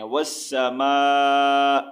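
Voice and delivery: والسماء with long "maa", stopped without the "i"; slow pausal والسماء, no final vowel; light final hamza. Shown as a demonstration. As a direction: hold the end of والسماء, close on a slight glottal catch. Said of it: "حمزہ کا ہلکا سا sound last میں".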